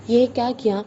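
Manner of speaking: ordinary delivery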